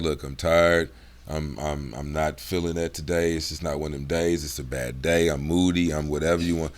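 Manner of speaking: low, soft and tired; serious, dropped voice. Shown as a demonstration. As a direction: drop it low, not up for it. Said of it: "I drop my voice".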